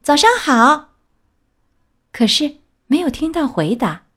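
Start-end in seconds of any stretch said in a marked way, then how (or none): 0.0-0.9 high, louder call into the house. Acted. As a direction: call out to grandma. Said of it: "她大声叫道". (小红帽大声喊“早上好！”)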